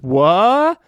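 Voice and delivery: high, loud startled question; hammed-up shocked hero; mock surprise. Muscle frozen in time, high startled yelp. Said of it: "Muscle says".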